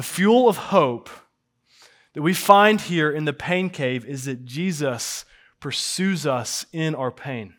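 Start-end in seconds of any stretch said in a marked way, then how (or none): none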